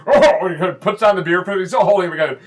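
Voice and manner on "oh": straining grunt. Acted. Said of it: "and he goes".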